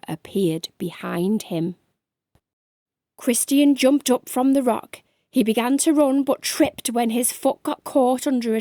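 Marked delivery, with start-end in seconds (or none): none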